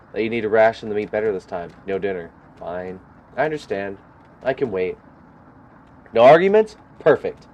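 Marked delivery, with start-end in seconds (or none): 6.1-7.5 mock delight. Character approves of skipped dinner.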